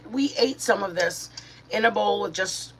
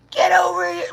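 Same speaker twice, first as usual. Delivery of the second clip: much higher, louder mock scream; imitated yell at child; 11 semitones higher, 7 dB louder than usual. Parent screaming at a toddler, imitated shout. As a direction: snap at a small child. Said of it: "somebody screaming at their kids".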